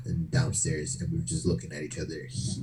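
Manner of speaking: ordinary delivery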